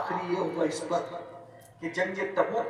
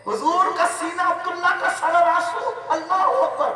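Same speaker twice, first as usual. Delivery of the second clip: overcome with emotion; 9 semitones higher, 7 dB louder than usual. Real, not acted. Preacher's emotional climax.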